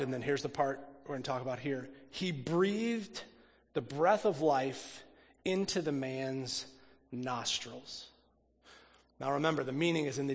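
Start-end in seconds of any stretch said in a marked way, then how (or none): 2.1-8.1 slow, deliberate scripture read; measured reading of the verse. Acted. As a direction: let each phrase of the verse land slowly. Reads scripture verse slowly.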